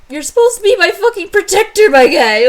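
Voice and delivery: high, loud indignant yell; mock outrage. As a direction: berate your useless bodyguard. [name] angry at protector.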